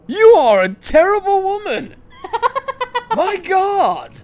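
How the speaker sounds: real laughing outcry; laughing outburst. Real, not acted. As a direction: burst out in laughing disbelief. Outraged laugh at the scene.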